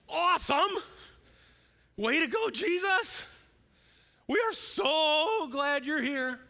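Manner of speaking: high, loud hammed-up cheering; mock-ecstatic fans, stretching the words out at the top of the voice; gushing praise. Mimicking disciples' amazed praise of Jesus.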